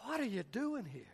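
quiet divine question. Acted. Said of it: "God asked Elijah this question".

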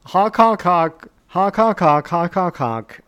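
loud, goofy clown horn honks; silly clown. Pretend.

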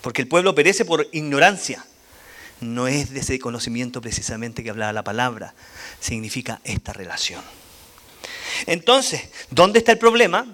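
usual speaking voice

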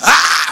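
sudden loud "Ah!" holler; preacher's shout. Shown as a demonstration. Preacher demonstrates to audience how his forefathers shouted, loud holler. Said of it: "they were preachers who shouted".

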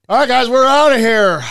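loud, high show-closing call; shouted sign-off. Real, not acted. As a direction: call out to the audience to wrap it up. Host calls out the sign-off.

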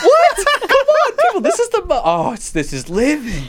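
laughing protest. Real, not acted. Host protests his friends' refusal, laughing loud. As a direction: protest while losing it to laughter.

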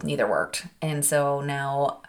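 ordinary talk, nothing marked

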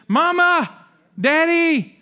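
high, loud wail of Mama, Daddy; child calling. Retold or imitated. Preacher imitating a kid giving up and calling parents, high and loud. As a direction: cry out for your parents like a stuck toddler.

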